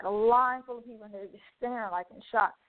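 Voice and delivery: ordinary talk, nothing marked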